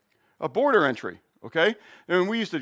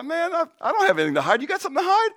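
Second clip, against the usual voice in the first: 1 cocky challenge; 12 semitones higher, faster than usual. Retold or imitated. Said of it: "I said".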